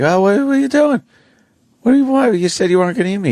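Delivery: high, panicked little critter voice; squeaky little mammal. Retold or imitated. Little mammal pleading with the alligator, high and panicked. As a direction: squeak it out like a scared small animal.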